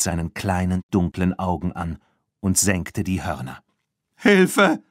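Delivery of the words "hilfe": high, loud cry for help; screaming for help. A narrator's reading. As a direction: shout it in panic at the charging bull. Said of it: "schrie Lupin".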